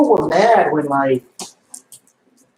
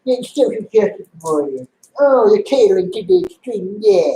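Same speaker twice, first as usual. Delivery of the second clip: mocking whiny fan; 5 semitones higher than usual. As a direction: parody the outraged comic reader, pitched up. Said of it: "it's like".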